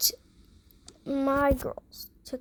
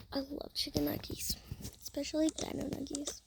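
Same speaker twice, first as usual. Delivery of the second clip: soft, breathy aside; hushed confession of a food love; whisper; 8 dB quieter than usual. Acted. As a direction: whisper it like a secret treat. Whispered aside while eating.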